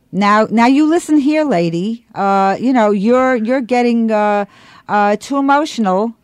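loud condescending scold; nasty scolding. Retold or imitated. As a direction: talk down to her, loudly. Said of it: "got really, really nasty".

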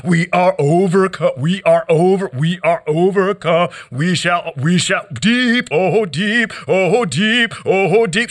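rhythmic, insistent spoken-word chant; poetry performance. Acted. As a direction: worry each word like a drumbeat. Performed poem continues.

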